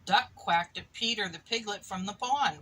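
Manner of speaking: ordinary delivery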